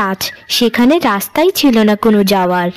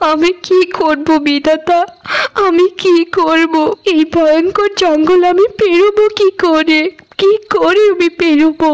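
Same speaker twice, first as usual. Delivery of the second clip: tearful; 6 semitones higher than usual. Acted. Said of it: "Payel অনেক কান্না করতে শুরু করে".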